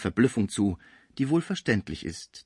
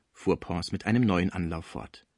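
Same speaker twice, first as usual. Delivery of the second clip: plain reading voice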